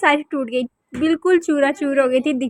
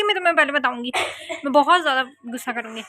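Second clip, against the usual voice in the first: normal talking voice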